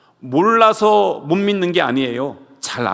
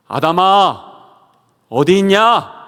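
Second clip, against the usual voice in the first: loud searching call; 4 semitones higher, 6 dB louder, slower than usual. Retold or imitated. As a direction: call across the garden for Adam. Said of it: "불러주십니다". (아담을 크게 부르는 하나님)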